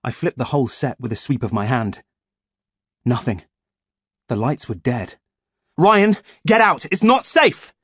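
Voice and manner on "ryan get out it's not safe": high, loud, alarmed shout. A narrator's reading. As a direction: yell a frightened warning. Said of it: "I shouted".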